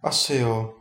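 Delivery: subdued, mildly downcast reply; dejected. A narrator's reading. Ondřej confesses his love to the wizard, dejected. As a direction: admit it with a touch of gloom. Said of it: "řekl skormouceně Ondřej".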